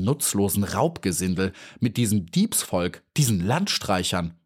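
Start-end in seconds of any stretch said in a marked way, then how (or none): none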